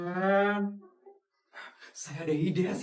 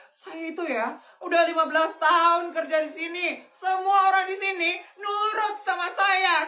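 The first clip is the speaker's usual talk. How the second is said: arrogant threat; 8 semitones higher than usual. Acted. Arrogant boss threatens new hire.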